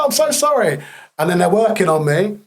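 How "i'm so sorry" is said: high, squeaky mock apology; apologetic medic. Medic apologising to the soldier who punched him, high and flustered. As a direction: play the medic flustered and sorry.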